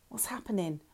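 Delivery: a tiny frightened question, barely voiced; small scared voice. A narrator's reading. Madsen asks Lauren as the boat sinks, small and scared. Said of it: "Madsen's small, scared voice".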